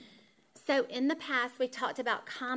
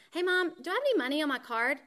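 put-on higher, quick, louder kid asking; imitating a child; 6 semitones higher, 5 dB louder, faster than usual. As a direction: play the kid breezily asking for money. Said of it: "the kid texted and said".

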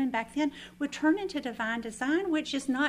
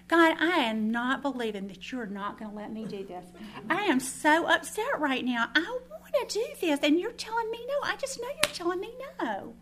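upset pleading with God; 7 semitones higher than usual. Retold or imitated. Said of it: "I was chapped".

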